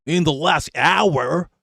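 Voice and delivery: slow, drawn-out mock Yankee accent, "hour" rising. Streamer mocks the news reporter's Yankee accent, slow. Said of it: "that Yankee accent is mad".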